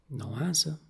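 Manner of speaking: plain reading voice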